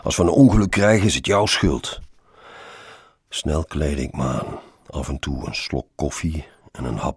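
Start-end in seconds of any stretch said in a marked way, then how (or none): 0.0-2.0 fast, raised and louder; impatient scolding. A narrator's reading. Colleague below blaming his late passenger, calling impatiently. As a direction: call out a rushed, higher-pitched reproach.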